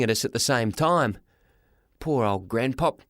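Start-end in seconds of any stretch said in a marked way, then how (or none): none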